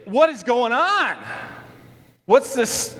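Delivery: high-pitched mock exasperation; exasperated disbelief. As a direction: act out walking in on chaos. Jesus finding chaos below.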